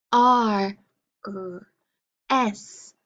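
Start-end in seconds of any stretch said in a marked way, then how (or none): 1.3-1.7 just the R sound, softly. Shown as a demonstration. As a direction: say "R" as a quiet growled rrr, no vowel. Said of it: "say the sound it makes".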